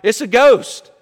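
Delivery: high, loud cry of alarm; mock frightened cry. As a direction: cry out as the startled disciples, hammed up. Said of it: "And they cry out".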